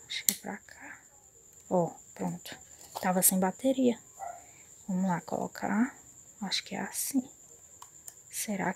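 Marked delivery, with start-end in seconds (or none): 0.1-2.6 hushed whisper while fiddling; whispered to herself. Real, not acted. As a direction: whisper it under your breath.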